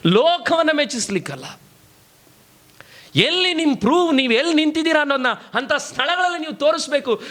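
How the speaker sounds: high-pitched, impassioned preacher; preacher in full flow; forceful preaching. Real, not acted.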